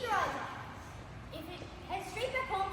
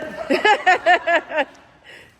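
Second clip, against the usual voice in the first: loud drawn-out laughter; laughing hard at the text; long laugh; 15 dB louder than usual. Real, not acted. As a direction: lose it laughing. Reader laughs after line.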